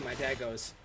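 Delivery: normal talking voice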